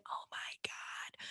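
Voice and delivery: slow, breathless whisper; whispered fangirl awe; awed whisper. Real, not acted. Gushing over the reunion.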